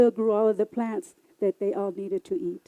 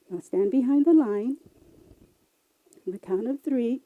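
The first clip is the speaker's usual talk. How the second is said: ordinary delivery, nothing marked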